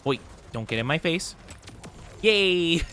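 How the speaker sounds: ordinary talk, nothing marked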